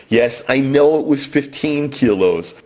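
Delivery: ordinary talk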